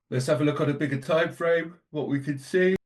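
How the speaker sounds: yawning mid-sentence. Real, not acted. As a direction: talk through a yawn. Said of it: "Oh dear, excuse the yawning".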